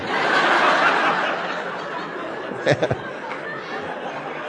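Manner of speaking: silent wheezing laugh; long unvoiced giggle; breathless laugh. Real, not acted. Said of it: "you guys are gross".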